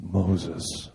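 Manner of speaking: low, soft voice of God calling a name; a lightly voiced deep divine call; Val Kilmer as God. Pastor doing Val Kilmer's God to the congregation, low and solemn. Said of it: "my best Val Kilmer".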